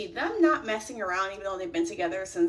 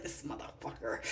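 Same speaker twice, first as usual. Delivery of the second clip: hushed curse, moved despite herself; unvoiced mutter; 8 semitones lower, 14 dB quieter than usual. Real, not acted. Moved while watching the show, a hushed curse. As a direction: breathe it out under the breath, unvoiced.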